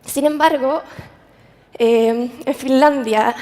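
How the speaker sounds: ordinary delivery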